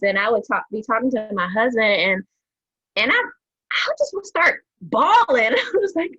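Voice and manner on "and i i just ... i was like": breathy high laugh through the words; laughing it off while admitting tears. Real, not acted. Laughing to friends about crying at her husband.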